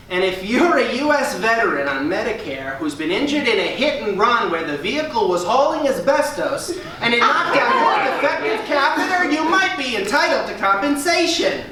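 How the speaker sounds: hammed-up TV ad read; lawyer ad parody. Comic spoofs injury lawyer commercial, hammed-up ad read.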